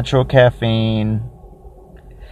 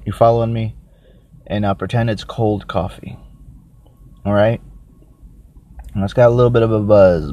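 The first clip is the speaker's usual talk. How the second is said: ordinary delivery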